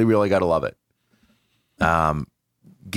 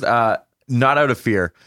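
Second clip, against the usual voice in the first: usual speaking voice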